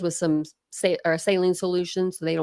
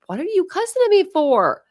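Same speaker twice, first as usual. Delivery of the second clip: higher and louder, incredulous; snapping back at a viewer who cussed at her, higher; indignant comeback; 11 semitones higher, 4 dB louder than usual. Retold or imitated. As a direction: fire back at the heckler in mock offense. Retelling answer to cussing viewer.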